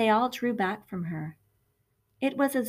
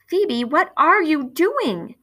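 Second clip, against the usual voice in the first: alarmed mother; 8 semitones higher, 8 dB louder, slower than usual. A narrator's reading. Mother reacts to the shout.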